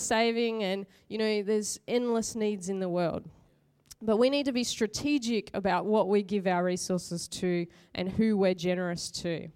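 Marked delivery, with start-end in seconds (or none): none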